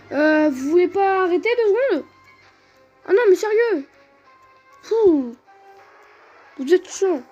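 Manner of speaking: high, loud exasperated scolding; raging gamer. Real, not acted. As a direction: scold the screen. Berating the game's Pokémon.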